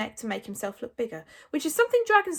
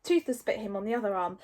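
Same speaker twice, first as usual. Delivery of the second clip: ordinary delivery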